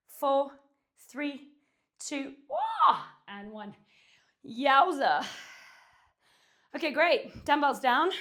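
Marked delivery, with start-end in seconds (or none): 2.0-3.0 sudden high yelp on the last rep; strain yelp. Real, not acted. Strain cry at rep end. 4.5-8.1 loud, high burst of relief; relieved exclaim. Real, not acted. Relief after finishing set.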